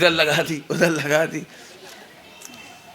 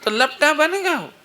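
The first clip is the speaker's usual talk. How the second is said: loud phone woman; 8 semitones higher, slower than usual. Retold or imitated. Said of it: "इतनी जोर जोर से बोल रही".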